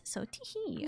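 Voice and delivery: high, soft, cutesy giggle; playful tee-hee. Real, not acted. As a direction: say it like a coy giggle.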